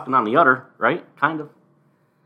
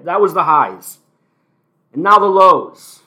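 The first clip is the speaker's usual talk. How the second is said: louder, higher host announcement; segment announcer; 5 semitones higher, 12 dB louder than usual. Acted. Host moving to the next segment, announcer voice. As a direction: present it like a show host.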